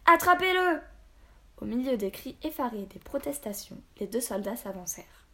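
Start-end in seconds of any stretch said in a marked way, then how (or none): 0.1-0.8 high, full-voiced shout; captain's urgent holler; yelled order. A narrator's reading. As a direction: yell the command to your men. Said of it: "Puis il hurla".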